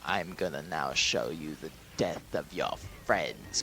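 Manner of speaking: low, sinister villain voice; hammed-up evil overlord, pitched low; menacing villain. Villain threatening Rey with her friends' death, low and menacing. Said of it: "he just goes like".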